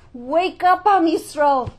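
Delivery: loud, high cry of wake up; roaring call. Acted. Lion roaring at Am Yisrael to wake up, loud cry. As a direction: roar it out like a lion. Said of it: "like a lion roaring and asking us".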